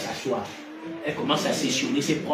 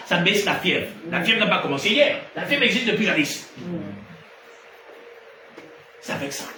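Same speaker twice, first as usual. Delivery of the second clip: loud, raised preaching; preaching at full force; 3 semitones higher, 4 dB louder than usual. Acted. Preacher teaching the church about healers, loud fiery preaching. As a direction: drive it home to the crowd.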